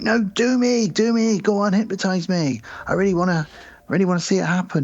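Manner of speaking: high-pitched, insistent imitation of a drunk man; drunken pleading. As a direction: beg like a tipsy party guest. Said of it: "he's a bit drunk".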